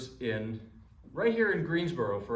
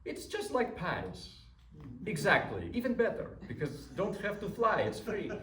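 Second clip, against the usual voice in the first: light imitated Russian accent, pitched higher; 7 semitones higher than usual. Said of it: "with his thick Russian accent".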